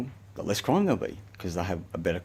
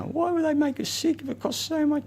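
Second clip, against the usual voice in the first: squeaky, much higher mock voice of doubters; 3 dB louder than usual. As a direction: send up the sceptics in a silly falsetto. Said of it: "People go".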